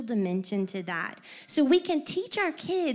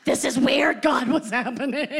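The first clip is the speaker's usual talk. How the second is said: loud, laughing through the words; baffled and laughing; laughing bewilderment; 8 dB louder than usual. Retold or imitated. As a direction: mom laughing at her bewildered self. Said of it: "I'm like".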